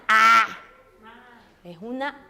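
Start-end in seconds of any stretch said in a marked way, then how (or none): 0.1-0.5 wide-open A, loud and held; big open Spanish A; Latin A. Shown as a demonstration.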